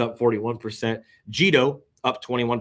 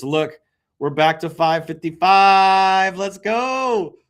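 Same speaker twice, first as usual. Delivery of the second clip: excited cheer; 9 semitones higher, 7 dB louder, much slower than usual. Real, not acted. Celebrates price back to normal.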